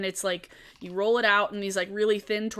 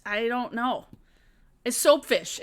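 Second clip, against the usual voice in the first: usual speaking voice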